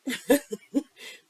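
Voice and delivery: loud laugh breaking out; bright burst of laughter. Real, not acted. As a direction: laugh out at yourself. Laughs at own words.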